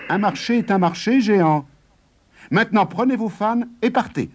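high-pitched, insistent retort; firm dismissal. A narrator's reading. Farmer's side holds the giant to the bargain, firm. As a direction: send the giant packing firmly.

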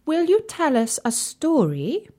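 high, gracious queen; queenly voice. Acted. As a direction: ask sweetly like a queen. Sultana asks for story.

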